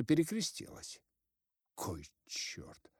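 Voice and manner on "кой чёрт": hushed, soft curse under the breath; startled whisper. Acted. Scribe cursing in the dark, whispered. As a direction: breathe it out in alarm.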